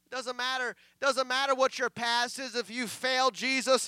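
loud, driving sermon delivery; preaching. Real, not acted. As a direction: preach it forcefully to the congregation. Preaches at full force.

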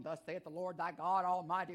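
put-on low, pompous old-time preacher voice; deep, formal mock prophet intonation; mock King James preacher. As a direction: ham up a stiff religious sermon tone. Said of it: "try to preach no hermeneutical hummus".

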